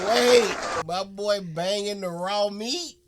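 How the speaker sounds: loud, high, disbelieving shout; hammed-up outrage at the joke; loud disbelief. Real, not acted. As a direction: yell it out in shock. Reacting to the joke.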